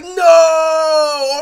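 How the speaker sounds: long, high, loud drawn-out no; outraged shout. Retold or imitated. Retelling a protest about rims, drawn-out shout. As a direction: wail it out at full volume. Acts out own outraged reaction.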